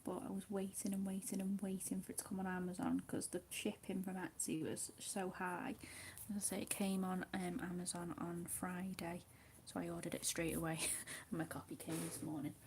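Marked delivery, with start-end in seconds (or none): 6.3-12.5 soft whisper over the colouring; hushed ASMR whisper. Acted. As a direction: whisper gently, as if not to break the calm.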